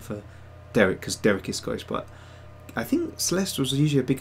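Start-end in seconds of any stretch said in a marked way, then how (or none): none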